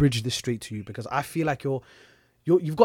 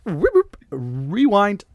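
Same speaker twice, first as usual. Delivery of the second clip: slow, higher mock tape rewind; 6 semitones higher, 5 dB louder, much slower than usual. Joking rewind call.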